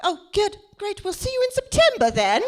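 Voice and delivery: light, high mock British accent; a hint of a British accent. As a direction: brush her off in a polite British accent. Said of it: "Love my British accent".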